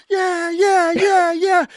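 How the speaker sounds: high, whooping yeahs; screaming with joy. Retold or imitated. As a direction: jump around cheering. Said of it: "I'm screaming, I'm dancing around".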